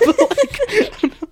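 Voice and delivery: squealing laugh; high burst of laughter. Real, not acted. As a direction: break into a high giggle. Laughing at his own impression.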